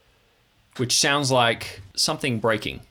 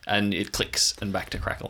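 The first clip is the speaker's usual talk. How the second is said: ordinary talk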